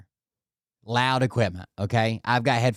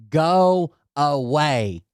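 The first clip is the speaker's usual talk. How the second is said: slow, higher mock yell with long vowels; mock scream; 4 semitones higher, 3 dB louder, much slower than usual. Screaming at coworker on a lawnmower, drawn-out mock yell. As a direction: draw each word out as a pretend shout. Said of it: "I'm screaming".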